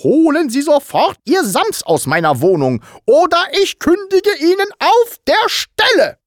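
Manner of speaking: escalating angry threat; threatening. A narrator's reading. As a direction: menace building from contained to high, loud fury. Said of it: "Sie sagte drohend".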